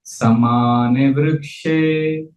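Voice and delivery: very slow, drawn-out Sanskrit verse; slow recitation. Shown as a demonstration. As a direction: stretch each syllable for repeating after you. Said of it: "say it slowly".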